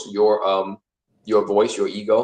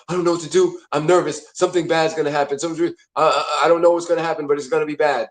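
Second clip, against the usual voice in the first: higher, hurried worrying, lightly acted; anxious inner voice; 4 semitones higher, faster than usual. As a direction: fret and stumble through it as anxiety. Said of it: "that's the anxiety dialect".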